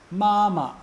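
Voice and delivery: "Ma, ma" as high level syllable, then short neutral one; high steady "Ma", brief unstressed "ma"; first then fifth tone. Shown as a demonstration. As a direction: hold "Ma" high and loud, drop off on "ma". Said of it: "that's first tone".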